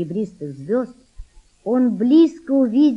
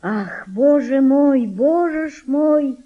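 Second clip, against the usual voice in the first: slow, drawn-out, higher lament; sighing lament; 5 semitones higher, 4 dB louder, slower than usual. A narrator's reading. Mother laments while rowing the boat, sighing slowly. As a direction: draw it out like a weary sigh. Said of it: "пробормотала она с тихим вздохом".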